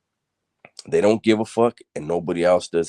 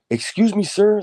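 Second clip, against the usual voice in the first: high-pitched call to a guard; calling for attention; 4 dB louder than usual. Retold or imitated. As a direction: call out high to someone passing. Inmate calls out to passing cop.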